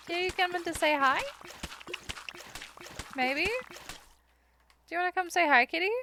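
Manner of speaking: high, sing-song coaxing to a cat; high cat-talk coaxing. Real, not acted. Coaxing her cat to come say hi, high baby talk. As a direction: coo the cat out from under the bed.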